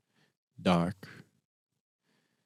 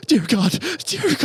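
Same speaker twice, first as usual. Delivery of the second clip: much higher and louder, panicked; terrified; 6 dB louder than usual. Acted. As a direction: gasp it out while dangling. Hanging on edge in horror.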